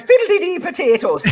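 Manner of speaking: very high, giggly Irish caricature; mock Irish accent. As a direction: squeal it in a hammed-up Irish brogue. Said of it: "Can I say that?".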